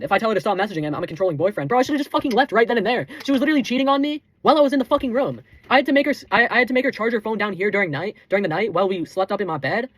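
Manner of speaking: ordinary delivery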